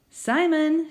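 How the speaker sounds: high, bright lightly voiced grandma; a hint of a confused old woman calling her son; grandma greeting with relief. A narrator's reading.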